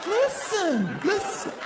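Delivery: high, pleading repeated listen; mock nagging girlfriend. Comic imitating a clingy girlfriend, high whiny pleading. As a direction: whine it high like a needy girlfriend.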